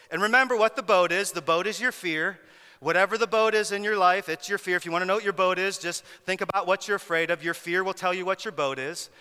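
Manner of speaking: ordinary delivery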